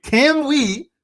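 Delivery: loud, high, drawn-out hype; rally the crowd at the top of your voice; excited streamer shouting a challenge; hyped-up rallying cry. Real, not acted. Rallying viewers toward 400.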